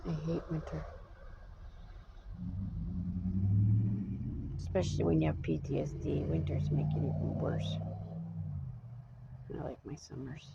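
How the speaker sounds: under-breath complaint; quiet grumbling aside. Real, not acted. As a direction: mutter it to yourself. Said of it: "much softer muttering aside".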